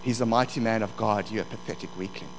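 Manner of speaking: low, quiet insinuating taunt; the enemy's soft, belittling inner voice. Acted. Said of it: "the voice of the enemy kept saying this".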